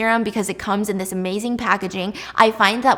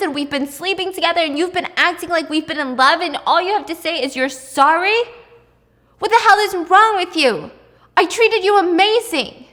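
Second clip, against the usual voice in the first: higher and louder, building to a shout; furious yell; 6 semitones higher, 4 dB louder than usual. Retold or imitated. Boyfriend yelling at his cheating girlfriend, furious and rising. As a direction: let the betrayal boil over into yelling.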